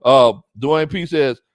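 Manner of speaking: ordinary delivery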